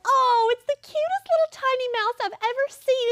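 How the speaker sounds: high gushing mock of Becca. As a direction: coo over it like a tiny pet. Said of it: "And she's like".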